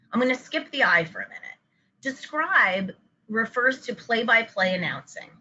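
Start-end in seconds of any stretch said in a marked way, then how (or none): none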